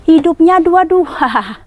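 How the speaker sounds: drawn out in disbelief; baffled. Real, not acted. Telling friends both blinkers lit, stunned and slow.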